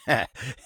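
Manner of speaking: short, amused chuckle; quick little laugh. Acted. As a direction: chuckle briefly along with a friend. Chuckles along with friend.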